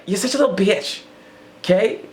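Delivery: put-on high, bratty kid taunt; mocking high child voice; childish taunt. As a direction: sneer it like a ten-year-old. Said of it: "I was like".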